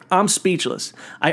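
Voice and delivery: ordinary delivery, nothing marked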